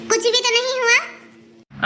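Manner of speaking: drawn-out, slow sulky denial; mother-in-law sulking at her son, slow and sullen. Acted. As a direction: make him drag it out of you.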